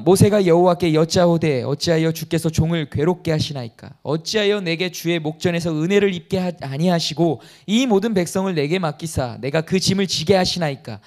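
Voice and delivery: loud, solemn Bible reading; scripture reading. Acted. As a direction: proclaim the verse to the congregation. Reads Moses' complaint from Numbers. (큰 소리로 성경 봉독)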